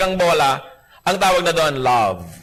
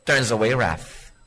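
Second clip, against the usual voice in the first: scripture reading; 3 semitones lower, slower than usual. Shown as a demonstration. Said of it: "Sabi sa Bible".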